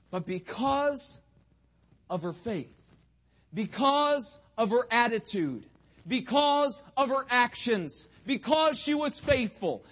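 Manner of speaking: rising, forceful sermon refrain; climactic preaching. Real, not acted. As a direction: hammer each reason from the pulpit.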